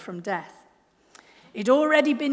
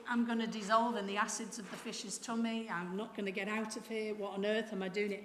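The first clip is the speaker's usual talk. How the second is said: inward thoughts; 2 semitones lower, 9 dB quieter than usual. Acted.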